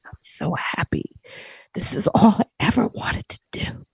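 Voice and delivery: hushed and breathy, full of emotion; soft whisper of a mother fulfilled; whispered tearful reply. Retold or imitated. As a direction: whisper as the overjoyed mom. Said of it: "She said".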